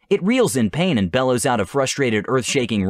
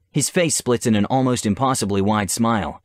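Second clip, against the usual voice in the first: ordinary talk, nothing marked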